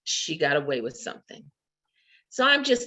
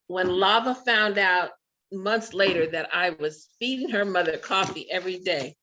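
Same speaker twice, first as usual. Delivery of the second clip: normal talking voice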